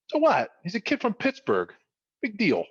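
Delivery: high-pitched, unimpressed dad; dismissive. Retold or imitated. Voicing dad belittling star's fame.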